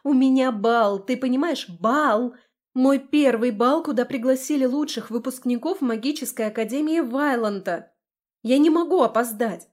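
despairing outburst about being late; desperate. A narrator's reading. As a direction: stress each point in rising panic. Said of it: "В моём голосе промелькнуло отчаяние".

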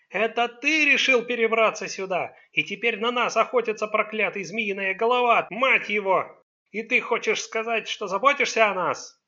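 high-pitched, loud tirade; accusing. A narrator's reading. Son blames father for the family's danger, accusing.

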